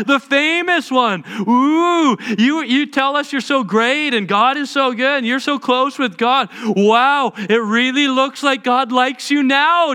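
loud, high, sarcastic taunting; mocking jeers. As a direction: sneer and drag out the mockery. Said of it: "Insults at him".

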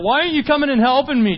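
high, loud, rapid demand; exasperated pleading. Acted. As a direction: fire off a desperate complaint. Pleading as the hurting believer.

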